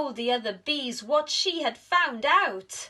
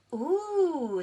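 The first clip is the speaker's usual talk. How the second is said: high, wondering little-bee ooh; 8 semitones higher than usual. Acted. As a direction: coo like tiny curious bees. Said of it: "said the little bees".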